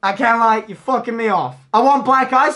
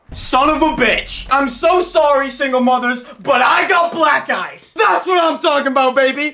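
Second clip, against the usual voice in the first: raised, crowing celebration; triumphant excitement; 5 semitones higher than usual. Real, not acted. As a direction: crow over the win in a high voice.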